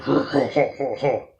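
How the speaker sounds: loud, mock villain ho-ho laughter; menacing laugh. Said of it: "all menacing and laughter".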